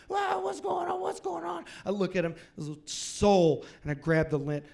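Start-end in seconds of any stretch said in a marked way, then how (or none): 0.1-1.7 much higher panicked mom voice; mock a mom freaking out on the phone. Sister panics hearing her son, high mock panic. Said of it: "I hear her freaking out".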